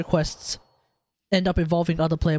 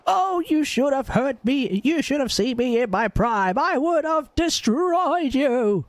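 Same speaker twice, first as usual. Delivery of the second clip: put-on high, slow old lady boast; drawn-out grandma bragging; Grandma Toff voice; 7 semitones higher, slower than usual. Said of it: "Tell me that's not Grandma Toff".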